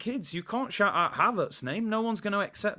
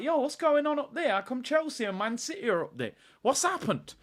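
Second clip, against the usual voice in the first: confused; 6 semitones higher than usual. Pretend. Confused fan looking up mountain.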